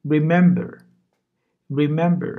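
Remember said as rimembe, loud and clear; model pronunciation. Shown as a demonstration. Teacher models Remember for learners, loud and clear. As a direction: read Remember aloud like a flashcard. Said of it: "Bu da hatırlamak demek".

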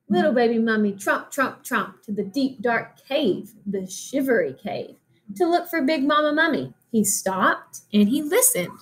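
ordinary delivery

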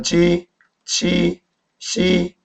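지, 치, 시 held long and level; slow model of Mandarin initials; pronunciation demo. Shown as a demonstration. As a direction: sustain each of 지, 치, 시 separately. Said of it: "이거 어떻게 발음해요?".

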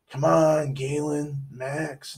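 usual speaking voice

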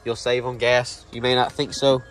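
ordinary talk

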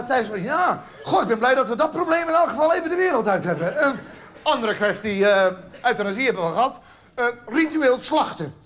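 usual speaking voice

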